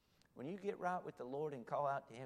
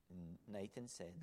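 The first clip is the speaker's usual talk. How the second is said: ordinary talk, nothing marked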